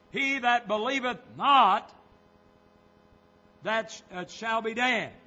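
loud key phrases with deliberate pauses; emphatic pulpit argument; forceful preaching. Real, not acted. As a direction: drive the question home to the congregation. Preaches on the verse.

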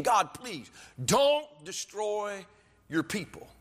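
high, drawn-out mock plea to God; begging. Moses intercedes with God for the people, begging. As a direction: stretch the words out in earnest intercession. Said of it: "He's begging God".

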